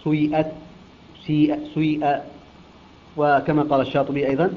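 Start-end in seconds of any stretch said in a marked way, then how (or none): 0.0-2.2 سيئت with a brief u tinting the long i; ishmam. Shown as a demonstration. Teacher showing Qalun's way, سيئت with ishmam. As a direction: round into سيئت on short u, then i. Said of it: "فيقرؤون بالإشمام".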